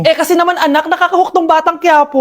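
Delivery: ordinary talk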